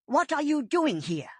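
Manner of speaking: lightly voiced warrior growl. A narrator's reading. Whitestorm confronting Firepaw in the forest, mild growl. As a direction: challenge the apprentice sharply. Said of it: "growled Whitestorm".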